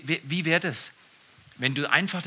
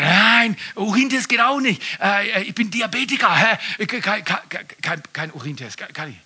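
put-on stammering drunk excuses; 5 semitones higher, 5 dB louder than usual.

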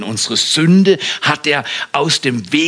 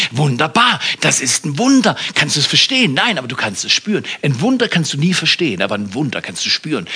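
ordinary delivery

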